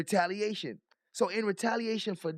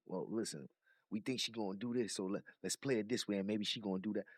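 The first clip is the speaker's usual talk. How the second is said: lower, softer mock plotting voice; scheming official voice; 13 semitones lower, 8 dB quieter, faster than usual. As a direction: scheme it low under your breath. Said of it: "They playing the game".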